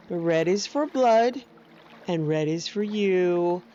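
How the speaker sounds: ordinary delivery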